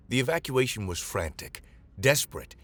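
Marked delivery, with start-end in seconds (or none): none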